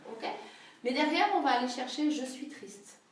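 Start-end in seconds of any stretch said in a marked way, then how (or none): none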